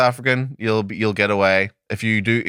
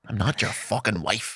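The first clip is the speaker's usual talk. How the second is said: slow, softer quoted game line; mock game character; 6 dB quieter, slower than usual. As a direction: draw out a lightly imitated game line. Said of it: "that's a reference".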